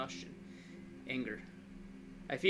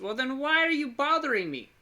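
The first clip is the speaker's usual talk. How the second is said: higher, louder annoyed comeback; exasperated retort; 9 semitones higher, 6 dB louder than usual. Acted. Patient to the visitor who woke them, exasperated. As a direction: throw the question back, loud and irritated.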